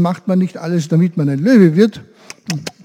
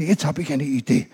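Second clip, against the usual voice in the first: wise monkey's advice; 4 dB louder than usual. Acted. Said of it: "Und er sagte".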